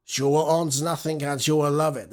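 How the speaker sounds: Klaus Schwab in a put-on German accent; German-accented quote, lightly hammed; mock German accent. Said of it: "That's in his German voice".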